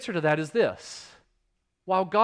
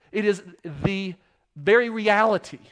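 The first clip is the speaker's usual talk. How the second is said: emphatic climax; 4 semitones higher, 6 dB louder than usual. Real, not acted.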